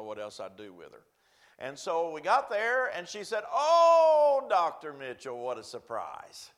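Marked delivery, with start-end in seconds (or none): 3.5-6.5 high and loud on oh, then slowing down; a delighted old woman caught off guard; surprised old lady. Retold or imitated. Old lady greets the visiting pastor.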